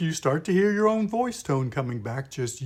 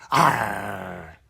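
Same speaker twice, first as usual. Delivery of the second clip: low, throaty pirate growl; 6 semitones lower, 5 dB louder than usual. Shown as a demonstration. Teacher showing the tight-throat sound, a low growl. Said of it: "Almost a growl".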